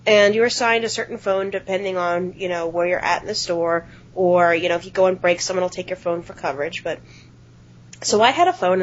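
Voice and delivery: ordinary delivery, nothing marked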